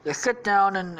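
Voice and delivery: ordinary delivery, nothing marked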